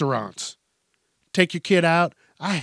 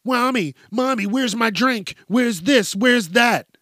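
put-on high-pitched kid calling for mommy; high, pestering child's voice; whiny kid; 6 semitones higher than usual. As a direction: whine like an impatient little kid. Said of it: "they hear".

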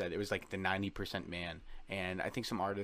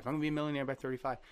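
rattled off fast and a bit higher; fast repeated mantra; 6 semitones higher, faster than usual. Retold or imitated. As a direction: chant it quickly like a mantra you repeat to yourself. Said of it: "I was always like".

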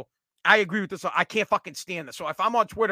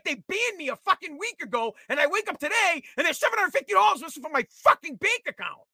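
indignant rant; 8 semitones higher than usual. Retold or imitated. Retold angry call, voice raised.

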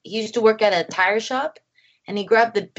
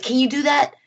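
retold eager request, higher pitch; keen director's question; 6 semitones higher than usual. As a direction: press the actor with an eager question. Director eagerly asking her actor.

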